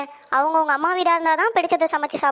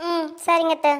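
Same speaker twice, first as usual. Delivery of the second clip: usual speaking voice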